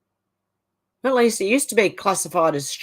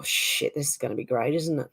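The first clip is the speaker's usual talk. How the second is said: normal talking voice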